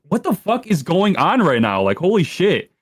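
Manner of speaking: high-pitched, bewildered disbelief; incredulous retold outburst; retold shock. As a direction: act out being stunned by sudden trouble. Retold shock at sudden fight.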